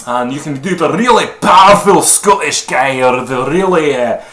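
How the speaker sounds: very animated, booming Scottish voice; high, loud Scottish stage voice; powerful theatrical Scottish. Shown as a demonstration. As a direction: go big and loud with an overt Scottish accent. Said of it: "theatrical Scottish voice".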